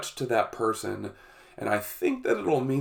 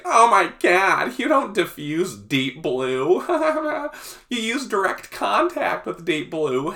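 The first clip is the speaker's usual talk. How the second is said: high, amused, incredulous; mocking women; 8 semitones higher, 3 dB louder than usual. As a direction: laugh at him in disbelief.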